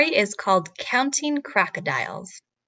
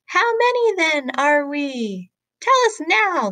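a hammed-up howl rising high; mock howl; 6 semitones higher than usual. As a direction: howl it up like impatient crocs. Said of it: "the crocs howled".

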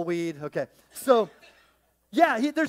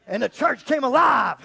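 louder playful shout of excitement; mock shout; 5 semitones higher, 10 dB louder than usual. Joking about the congregation coming alive, playful shout. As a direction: cheer as if the crowd erupted. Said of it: "And it's like".

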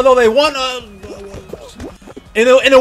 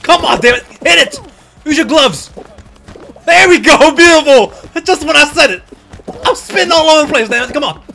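excited, exasperated gamer yelling; raised pitch, loud outbursts at the game; 7 semitones higher, 6 dB louder than usual. Real, not acted. Player shouting at teammates mid-match, yelling. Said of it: "I feel like I'm yelling".

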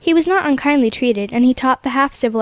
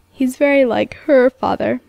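husky voice. A narrator's reading. Son to his father about the dead wife, husky. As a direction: speak through a tight, husky throat. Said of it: "he said in a husky voice".

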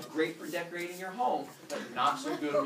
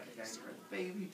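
quick scheming mutter; 3 semitones higher, 9 dB quieter, faster than usual. Pretend. Kronos scheming aside.

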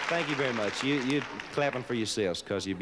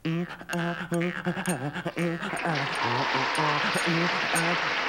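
raised pitch twanging ee ah syllables; a hammed-up twang, pitched up; high jaw harp imitation; 8 semitones higher, 3 dB louder, slower than usual. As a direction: mimic a jaw harp boinging. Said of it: "This guy's going".

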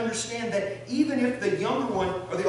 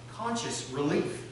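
usual speaking voice

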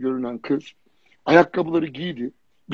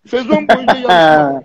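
mock announcer; 3 semitones higher, 10 dB louder than usual. Mocking the endless model contest.

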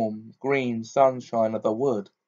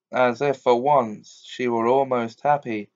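usual speaking voice

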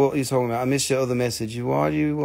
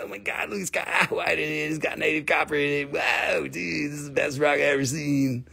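stoned dude; 5 dB quieter than usual. Retold or imitated. Mock stoner marveling at rock.

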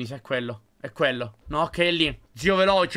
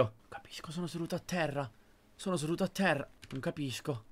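confused murmur; 10 dB quieter than usual. Real, not acted. Confused by game glitch.

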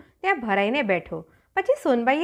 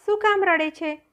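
concerned cranes; 7 semitones higher than usual. A narrator's reading. Cranes ask the little sister why she cries, lightly voiced concern. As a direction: lift the pitch in mild concern.

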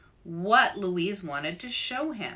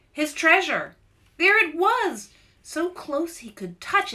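excited; 9 semitones higher, 6 dB louder than usual. A narrator's reading. Narrator excited discovery.

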